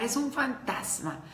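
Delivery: mock fearful cry. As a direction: act out the disciples' scared shout. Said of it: "empiezan a gritar".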